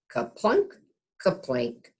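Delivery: ordinary delivery, nothing marked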